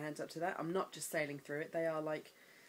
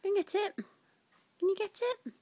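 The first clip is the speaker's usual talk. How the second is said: high, louder cooing; 13 semitones higher, 6 dB louder, slower than usual. Real, not acted. Mum coaxing baby at tummy time, high baby talk.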